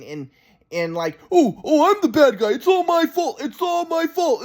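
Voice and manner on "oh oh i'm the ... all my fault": high, mocking self-pitying whine; sarcastic grandpa. Grandson mimics grandpa's sarcastic self-blame, mocking. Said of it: "sarcastic and".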